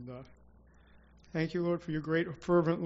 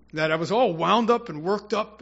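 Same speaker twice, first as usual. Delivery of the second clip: normal talking voice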